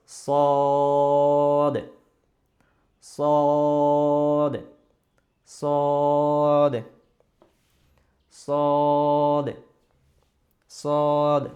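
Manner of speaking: "সদ" held long on one level note, loud; drawn-out Arabic Sad. Shown as a demonstration. Teacher showing the tongue-tip letter, "সদ" drawn out loud. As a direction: stretch the vowel of "সদ" loudly, then close it. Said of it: "দুই দাঁতের মাঝখান থেকে উপর পর্যন্ত".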